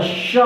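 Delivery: श with rounded lips, hushing like a whistle; isolated palatal श. Shown as a demonstration. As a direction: round the mouth and let श whistle out. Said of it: "सीटी की तरह उच्चारण होगा".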